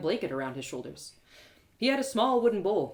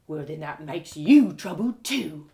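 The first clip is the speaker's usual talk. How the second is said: louder, slow scornful guard; gruff guard; 3 semitones lower, 6 dB louder, slower than usual. Acted. Castle guard lectures narrator about beggars, scornful. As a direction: sneer like a bossy castle guard.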